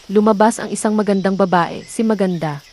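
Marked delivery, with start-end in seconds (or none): none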